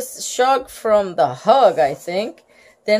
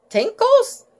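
high squeaky tinkles; 12 semitones higher than usual. Acted. Lucille misspeaks tingles, high squeal.

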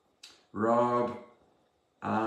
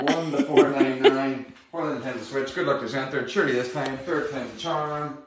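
upbeat raffle draw call; raffle caller; 5 dB louder than usual. Acted. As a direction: announce the draw to the stream.

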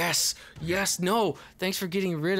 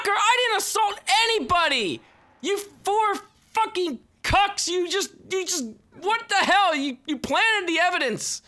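outraged yelling; 10 semitones higher than usual. Real, not acted. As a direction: yell at the cops that you're innocent. Arrested in game, protests.

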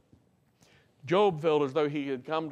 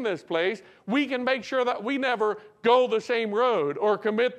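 higher-pitched, driving sermon voice; fervent preaching; 7 semitones higher, 4 dB louder than usual. Acted. Preacher urging the beloved to examine their lives, fervent preaching. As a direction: press each point home to the congregation.

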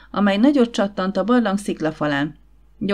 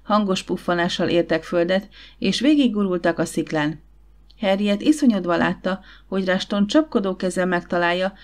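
ordinary delivery

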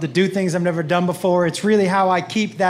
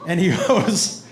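ordinary delivery

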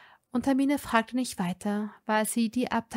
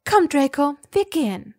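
higher and louder, firm; decided, no discussion; determined; 5 semitones higher, 7 dB louder than usual. A narrator's reading. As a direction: resolute command.

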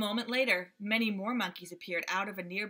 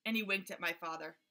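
ordinary delivery

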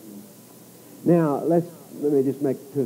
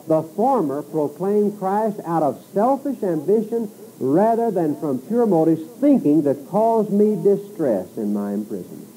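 raised, lifted pulpit reading voice; preacher reading Paul's letter aloud, voice raised; scripture reading; 6 semitones higher than usual. Acted. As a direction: read the passage out to the congregation. Reads scripture passage aloud.

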